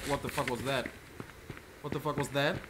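normal talking voice

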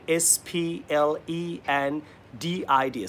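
slow, letter-by-letter spelling. Shown as a demonstration. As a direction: spell the word out slowly for students. Spelling out the new word for students. (ধীরে ধীরে অক্ষর ধরে বানান)

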